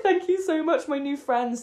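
put-on high-pitched drunken gushing; hammed-up overjoyed thanks; mock gushing gratitude. Drunk woman thanking girls she just met, mock gushing gratitude.

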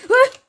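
high startled scream; sharp scream. Real, not acted. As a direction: yelp out in alarm. Hit in game.

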